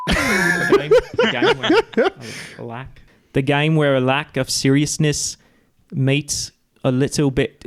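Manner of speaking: mock Australian accent drifting British; Australian accent attempt. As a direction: put on a wobbly Australian accent. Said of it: "It was New Zealand at first, and then it went British".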